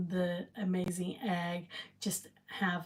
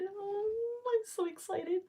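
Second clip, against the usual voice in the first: high squeal of excitement, softer; 14 semitones higher, 4 dB quieter than usual. Real, not acted. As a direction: squeak it out, thrilled. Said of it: "And I am so excited".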